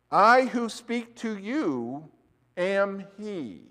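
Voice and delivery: slow, hushed and solemn, a lightly voiced divine declaration; solemn Jesus voice. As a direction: let each word land slowly, as a holy revelation. Jesus quoted revealing himself.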